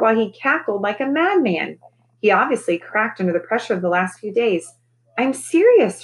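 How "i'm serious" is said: higher, louder shriek. A narrator's reading. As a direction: shriek it out in a high, loud voice. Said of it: "she shrieked".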